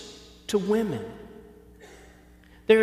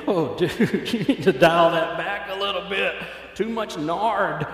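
amused, louder teasing nudge; laughing mock scolding; 4 dB louder than usual. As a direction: laugh and tell a stranger to tone it down. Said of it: "and you think".

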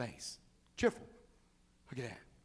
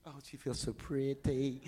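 mock dainty delight; 5 semitones lower, 7 dB quieter than usual. Voicing a pampered woman's delight.

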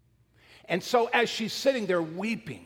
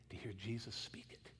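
whisper; 8 semitones lower, 18 dB quieter than usual. Real, not acted. Awed hush over Jesus' voice.

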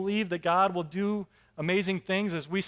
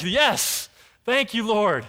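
joyful thanks; 3 semitones higher, 6 dB louder, slower than usual. Retold or imitated. Gideon rejoicing at God's sign.

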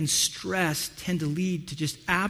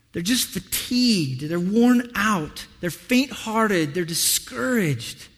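slow and weary; slower than usual. Acted. Describing weary people slowly.

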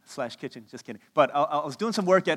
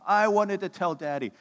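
higher, louder mock wail; imitated crying child; 5 semitones higher, 5 dB louder than usual. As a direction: wail it like a thwarted kid. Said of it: "Elise starts crying".

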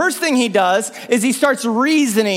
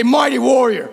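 warrior voice; 2 semitones higher than usual. Shown as a demonstration. Preacher modelling the warrior voice for the crowd, lightly bold. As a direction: give it a hint of warrior swagger. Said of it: "say it in like a warrior's voice".

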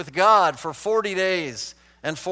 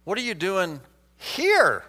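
slow question with "here" raised and drawn out; stress on HERE; 2 semitones higher, slower than usual. Shown as a demonstration. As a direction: save the weight for "here". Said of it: "Or how about".

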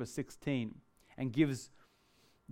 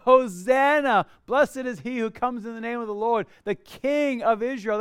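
higher, louder Hosanna that eases off; crowd crying out; 6 semitones higher, 5 dB louder than usual. Retold or imitated. Crowd hailing Jesus entering Jerusalem, higher proclaiming cry. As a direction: proclaim it like a cheering crowd, softening. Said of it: "They're crying out".

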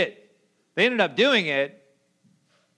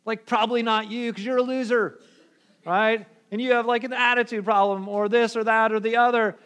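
mock bickering; 5 semitones higher, 3 dB louder than usual. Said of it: "they're arguing about".

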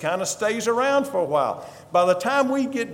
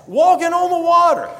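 high and loud, full of wonder; astonished, voice leaping up high; amazed exclaim; 10 semitones higher, 7 dB louder than usual. Retold or imitated. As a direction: cry it out like you can't believe it. Repeated with wonder.

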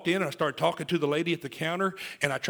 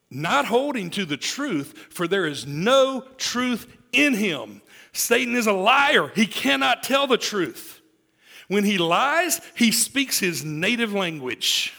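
loud, high and slow, each phrase driven home; slow, booming pulpit proclamation; preaching at full force; 6 semitones higher, 7 dB louder, slower than usual. Acted.

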